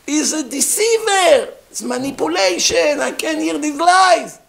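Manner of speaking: outraged Karaite yelling his denial at the king; screaming in outrage. Acted. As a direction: shriek it like you've been exposed. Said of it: "The Karaites screamed".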